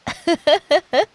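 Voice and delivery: short giggle at his request; amused teasing giggle; amused laugh. Real, not acted. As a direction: laugh at how cute he is. Laughs at his bedtime story request.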